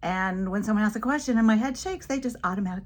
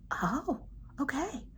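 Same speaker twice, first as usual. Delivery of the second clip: put-on soft, higher child voice; little boy's acceptance; 7 semitones higher, 6 dB quieter, much slower than usual. Little boy hears teacher's explanation, soft and accepting. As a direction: lightly imitate a child taking it in.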